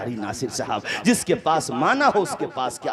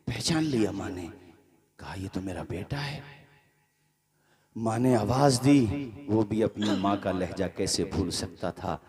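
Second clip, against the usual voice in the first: low, soft, grieving narration; hushed sad storytelling; 7 semitones lower, 5 dB quieter than usual. Acted. Preacher tells the ruined son's fall, hushed and grieving. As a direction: draw them into the son's downfall quietly.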